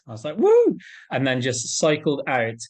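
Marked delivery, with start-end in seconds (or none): 0.4-0.8 high, loud whoop of joy; whooping cheer. Retold or imitated. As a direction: let out a jubilant woo. Retold cheer setting off.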